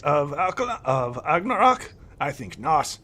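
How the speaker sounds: put-on high, proud old elf; dismissive elder. Old elf insisting he knows his druids. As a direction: wave it off like a haughty elder.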